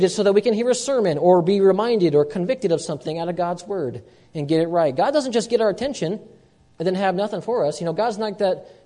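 normal talking voice

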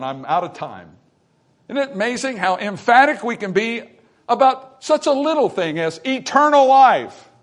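preaching at full force. Acted. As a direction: preach it hard to the church.

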